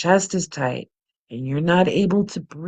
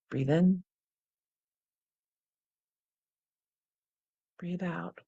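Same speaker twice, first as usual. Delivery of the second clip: guided breathing; 8 dB quieter than usual. Acted. Breathing cue again.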